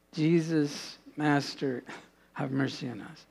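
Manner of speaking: the same cry again, dropped to a near hush; hushed plea. Retold or imitated. Lepers at a distance begging Jesus, hushed and pleading. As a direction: plead softly, barely voiced.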